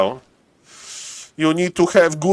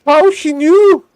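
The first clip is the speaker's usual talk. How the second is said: squeaky voice; 14 semitones higher, 4 dB louder, slower than usual. Pretend. High squeaky mock question.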